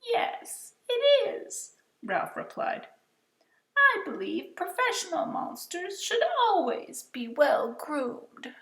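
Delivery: very high, refined monster voice; high silky voice. Acted. Monster Ralph introducing himself in a high silky voice. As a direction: sound prim and well-mannered, up high. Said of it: "in a high, silky voice".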